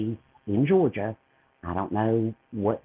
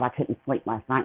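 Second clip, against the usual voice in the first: ordinary talk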